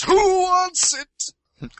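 drawn-out high showman's call; hyped announcer. Retold or imitated. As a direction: auction it off like a hammy showman. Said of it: "It's like".

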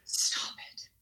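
soft unvoiced stop it; hushed amazement; whispered disbelief. Real, not acted. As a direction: breathe it in disbelief. Disbelieving reaction.

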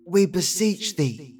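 slow, hushed medium summoning spirits; seance medium. Acted. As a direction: intone it like an old-fashioned medium. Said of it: "why people thought ghosts talk like serfs".

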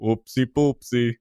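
hammed-up Russian accent, slow on the first words; a goofy deadpan Russian voice as he slides off; playful Russian accent, lightly put on; mock Russian accent. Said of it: "doing a Russian accent for most of the video".

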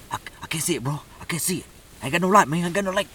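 lightly voiced buddy complaining he can't see; imitating J Paul. Hunting buddy whispering in the blind he has no light, lightly imitated. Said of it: "You, you know how he talks".

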